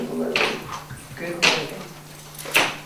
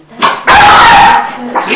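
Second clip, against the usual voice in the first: much higher, louder burst of joy; joyful praise; 5 semitones higher, 15 dB louder, much slower than usual. Shown as a demonstration. Trainer praising her dog with bright excitement. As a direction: cheer her on like she just nailed it. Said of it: "there was a joy in my voice".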